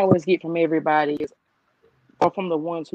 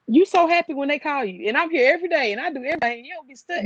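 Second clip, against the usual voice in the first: indignant complaint; 7 semitones higher than usual. Retold or imitated. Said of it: "I'm just like".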